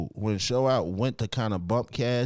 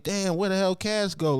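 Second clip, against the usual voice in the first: pitched-up surprised quote; half-amazed, raised voice; 9 semitones higher than usual. Retold or imitated. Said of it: "I was like".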